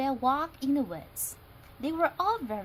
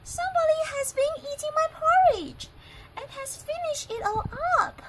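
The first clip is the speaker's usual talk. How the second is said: very high, loud and fast little squeak; Baby Bear squeaks; 6 dB louder, faster than usual. Acted. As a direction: squeak like a tiny upset cub. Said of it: "squeaked in his little voice".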